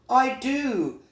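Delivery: high little bee voice; high-pitched storybook character; Little Bee, eager. Acted. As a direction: answer keenly as a small bee.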